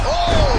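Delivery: sharp high "oh"; excited gasp. Real, not acted. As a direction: yelp as the punch lands. Big strike lands mid-round.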